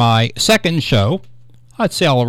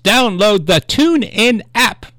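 slow, deliberate, higher-pitched plug; 6 semitones higher, slower than usual. Real, not acted. Radio host correcting a rushed app plug, slowed down. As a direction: say it slowly, every word spaced out. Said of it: "I'm talking too fast".

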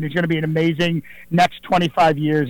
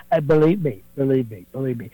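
Trump voice demo; 4 semitones lower than usual. Shown as a demonstration. Impressionist showing the host how he does Trump. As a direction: show the host the voice going up and down. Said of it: "a little bit of gravelly".

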